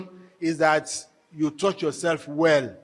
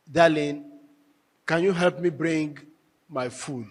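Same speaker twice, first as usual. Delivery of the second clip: gentle tone; 3 semitones lower than usual. Shown as a demonstration. Husband asking his darling for food, gentle tone. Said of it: "the kind of tone with which".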